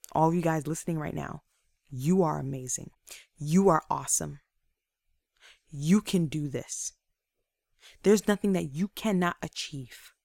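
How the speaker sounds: low, soft, earnest encouragement; sincere affirmation. Real, not acted. Speaker to listeners who doubt themselves, sincere encouragement. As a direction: speak softly and deliberately to lift them up.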